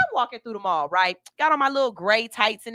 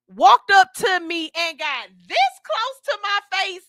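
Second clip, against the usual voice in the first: building outrage; 4 semitones higher, 8 dB louder, slower than usual. Real, not acted. Building up to the offense.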